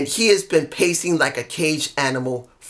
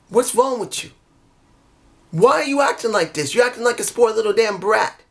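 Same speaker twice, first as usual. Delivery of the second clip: put-on pitched up, scolding a spoiled kid; exasperated scolding, quicker by the end; scolding champion; 6 semitones higher than usual. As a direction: chide him like a brat, picking up speed. Retells champion scolding a rival.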